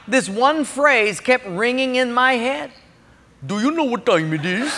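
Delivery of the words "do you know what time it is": imitated East Indian accent; put-on East Indian accent, questioning. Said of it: "from an East Indian accent".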